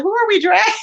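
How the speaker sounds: high-pitched retold question, tipping into laughter; amused excitement. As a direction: ask it high, about to laugh. Retells her eager reaction, breaking into laughter.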